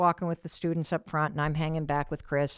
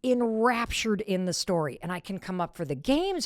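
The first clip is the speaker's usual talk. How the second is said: ordinary talk, nothing marked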